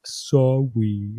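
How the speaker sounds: put-on a small sulky kid; dad lightly voicing his son apologizing; toddler apology.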